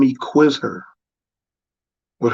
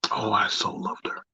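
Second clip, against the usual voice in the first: whispered, tender memory; soft fond whisper about her; 8 dB quieter than usual. Real, not acted. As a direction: breathe it out like a secret. Fond memory of first love.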